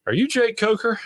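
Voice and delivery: high-pitched imitation of a lady stranger; lady asking. Lady on a bike asking Jake, high mock voice. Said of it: "and she goes".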